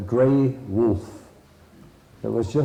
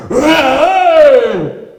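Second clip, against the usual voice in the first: long, high, very loud cry; buffalo's revived roar; 15 dB louder than usual. Acted. Buffalo hit by the healing eye, roaring high and long. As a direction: let out a huge drawn-out bellow.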